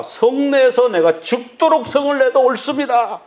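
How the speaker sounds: stubborn Jonah. Retold or imitated. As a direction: dig in, defiant to the end. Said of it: "요나가 말합니다".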